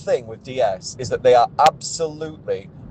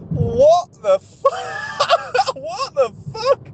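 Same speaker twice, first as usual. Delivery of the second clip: shouted disbelief breaking into a high cry of a laugh; incredulous shout; slower than usual. Real, not acted. Ranting to camera about the training day, shouting in disbelief. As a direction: yell it in sheer disbelief, then crack up. Disbelief at absurd sales advice.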